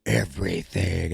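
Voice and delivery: low villain command; deep and menacing; Vecna. Retold or imitated. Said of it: "And he's like".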